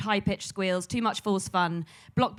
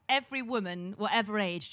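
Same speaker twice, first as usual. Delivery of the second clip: usual speaking voice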